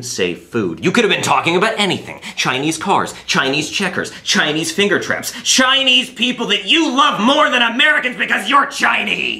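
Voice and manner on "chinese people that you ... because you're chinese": high, shouted accusation; worked-up, high-pitched rant; accusing rant. Acted. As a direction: build to a shouted charge. Interrogator's accusation builds.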